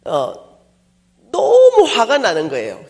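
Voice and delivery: ordinary delivery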